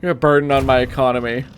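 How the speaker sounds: mock scolding. Scolding a city in game.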